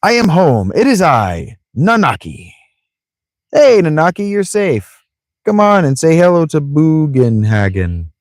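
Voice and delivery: high, swooping game-character voice; big high-pitched homecoming call; excited returning Nanaki. Acted. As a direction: announce yourself grandly, pitch leaping up.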